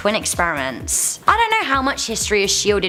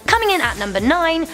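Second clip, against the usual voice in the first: higher, punchy countdown call; top ten countdown; 7 semitones higher than usual. Acted. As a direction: announce the next entry. Countdown announcement.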